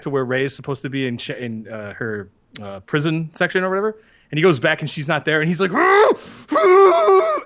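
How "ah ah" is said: high, loud frustrated cries; mock tantrum yells; hammed-up angry shouts. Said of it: "you can hear that sort of, he's really upset".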